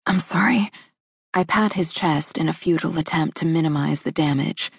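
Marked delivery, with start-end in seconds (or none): 0.0-0.7 whispering. A narrator's reading. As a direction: whisper apologetically after crying. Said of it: "ruined his shirt with my tears".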